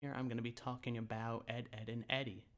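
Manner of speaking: timid YouTuber intro voice. Retold or imitated. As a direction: mumble it like a shy beginner afraid someone hears. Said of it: "we just start talking like this".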